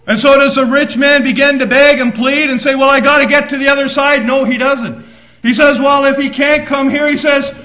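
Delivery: loud, driving preacher's voice; fiery preaching. Acted. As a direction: proclaim it to the church. Preaching at full force.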